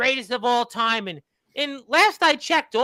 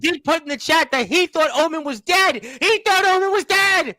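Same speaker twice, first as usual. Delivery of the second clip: high, raised voice over the din; shouting over crosstalk; 5 semitones higher, 3 dB louder than usual. Real, not acted. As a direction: push it higher to be heard over the argument. Host shouting over the squabble.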